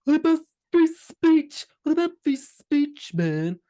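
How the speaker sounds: high, slow mocking whine; mocking online moron. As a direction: mimic a whiny online troll. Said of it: "the morons online going".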